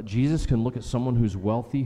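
ordinary delivery

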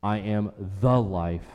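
slow, with "the" lengthened and weighted; "the" stressed as the one and only. Shown as a demonstration. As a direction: lean hard on "the" before "life".

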